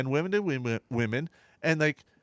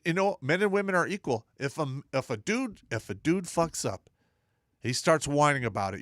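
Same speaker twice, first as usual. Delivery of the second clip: normal talking voice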